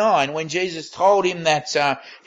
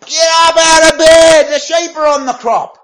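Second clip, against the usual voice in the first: much higher and louder, a booming shout; booming yell; 11 semitones higher, 9 dB louder than usual. Retold or imitated. Dad yelling at sleeping sons from the lounge room, booming shout. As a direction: bellow it across the house to wake the boys. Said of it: "yelled out in a booming voice".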